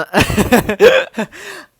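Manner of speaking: high, loud burst of laughter; big high-pitched laugh. Real, not acted. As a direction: crack up for real. Laughs after the feet remark.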